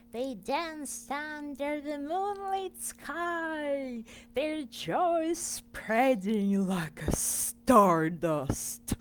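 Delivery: slow, loud over-the-top storytelling; exaggerated. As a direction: ham up the magic, slowly and loud. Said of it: "That's too much and it's exaggerated".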